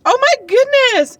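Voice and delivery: high, loud, drawn-out squeal of delight; excited. Retold or imitated. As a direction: imitate her bursting with excitement. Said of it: "she was all excited".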